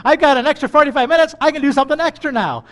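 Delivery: fast, high, loud eager voice; mock excited planning; eager rush. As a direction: rush it like a kid with free time. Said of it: "because our human nature is".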